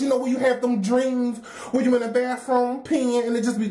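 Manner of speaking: normal talking voice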